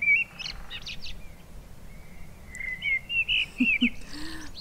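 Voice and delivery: high airy laugh; amused laugh. Acted. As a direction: laugh lightly at the idea of titles. Fae leader laughs at her title.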